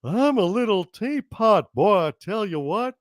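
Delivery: put-on cartoon dad voice; cartoon dad impression; Hank Hill. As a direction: do a cartoon suburban dad singing a nursery rhyme. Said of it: "Hank Hill sings".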